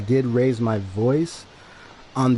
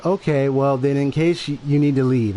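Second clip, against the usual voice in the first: normal talking voice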